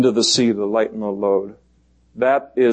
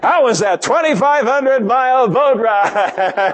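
high, drawn-out mock question; teasing voice of God; 10 semitones higher, slower than usual. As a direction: tease the runaway, breaking into a laugh at the end. Acts out the Lord teasing Jonah.

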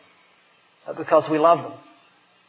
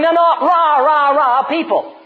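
a cheerleader's rah rah, higher and louder; mock cheer; 8 semitones higher, 4 dB louder, slower than usual. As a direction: chant it like a mock pep squad. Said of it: "start a cheerleading team".

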